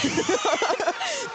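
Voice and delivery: squealing burst of laughter; high laugh. Real, not acted. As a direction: laugh out in relief. Laughing after good lift.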